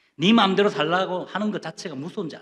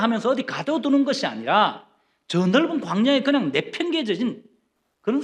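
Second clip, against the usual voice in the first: loud, high-pitched sermon delivery; forceful preaching; 8 semitones higher, 6 dB louder than usual. Acted. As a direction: drive it home from the pulpit.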